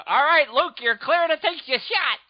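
loud, high kid acting out a dogfight; slow, high play-acted battle call; playing Star Wars pilot. Boy in his wagon calling to Luke, loud excited kid voice. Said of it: "you're going, you're going".

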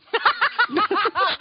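high burst of laughter; loud laughter. Real, not acted. Reacting to the joke.

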